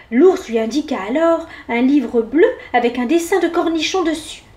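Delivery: usual speaking voice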